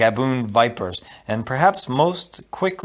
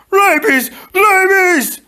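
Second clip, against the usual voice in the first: very high, loud shriek; panicked cry; 8 dB louder, much slower than usual. A narrator's reading. Fezzik terrified of the bats, panicked cry. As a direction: cry out in terror, second word higher. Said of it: "before Fezzik cried".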